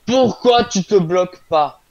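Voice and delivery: high, loud, slow raging; furious yelling. Real, not acted. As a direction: shout at the broken machine. Raging at failing redstone.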